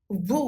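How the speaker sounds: ভু drawn out, buzzing v and long oo; Italian letter name vu. Shown as a demonstration. Teacher naming the letter for learners, ভু as Italian "voo". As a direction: say ভু as "voo", with a true v. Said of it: "উচ্চারণ হবে".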